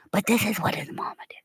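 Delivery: fast hushed whisper; quick whisper. Real, not acted. Telling viewers about his mother in a fast whisper. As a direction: rush it out under your breath. Continuing the secret story.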